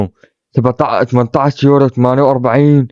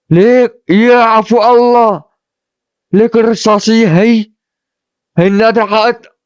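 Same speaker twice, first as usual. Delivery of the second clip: excited; 9 semitones higher, 4 dB louder, slower than usual. Real, not acted. Shocked at the rare one.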